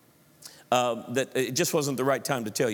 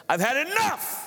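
exasperated outburst; 11 semitones higher than usual. Acted. Acting out exasperated parent.

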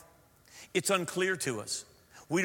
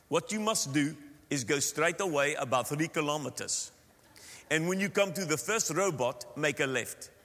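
put-on Afrikaans-accented English; matter-of-fact Afrikaner accent; South African accent; 2 semitones lower than usual. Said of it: "Now that's a South African accent of an Afrikaans".

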